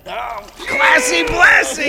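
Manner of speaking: high, drawn-out shout of recognition; excited shout. Real, not acted. Fan recognizing a wrestler's name, excited shout. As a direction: holler the nickname, stretched and loud.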